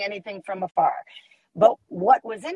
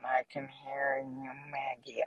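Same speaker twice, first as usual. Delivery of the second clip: lower, softer, slowed muffled echo; imitating underwater voice; 7 semitones lower, 12 dB quieter than usual. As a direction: mimic a stream slowed underwater. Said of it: "it sounds like you're underwater in slow motion".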